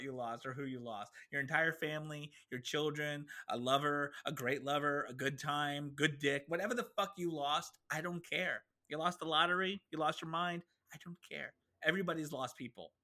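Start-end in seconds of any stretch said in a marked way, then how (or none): none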